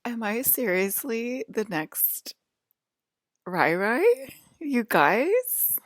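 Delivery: high, slow, hammed-up disbelief; imitated amazement. Asking the guys if it's real life, mock awe. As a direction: draw it out as a mock-awed put-on voice. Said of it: "He was like".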